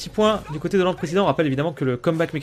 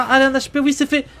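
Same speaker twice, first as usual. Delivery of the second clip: excited; 8 semitones higher than usual. Real, not acted. Caster cheers a pick.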